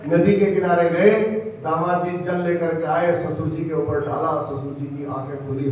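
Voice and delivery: ordinary talk, nothing marked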